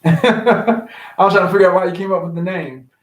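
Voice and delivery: laughing through the words. Real, not acted. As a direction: chuckle while you tease the name. Laughs at friend's name.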